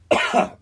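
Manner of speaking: short dry hacking cough; real cough from a head cold. Real, not acted. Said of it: "if you hear me hacking or coughing".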